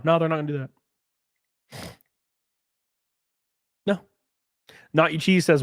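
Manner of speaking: ordinary talk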